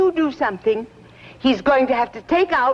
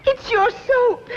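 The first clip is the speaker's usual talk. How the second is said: high, slow tearful wail; crying, voice breaking; 11 semitones higher, slower than usual. Acted. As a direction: sob it out, mock-tragic. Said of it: "got you to bawling like that".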